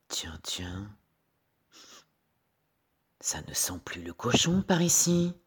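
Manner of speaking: airy wolf pausing for breath. A narrator's reading. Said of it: "essoufflé, il s'arrête pour respirer". (loup essoufflé, à bout de souffle)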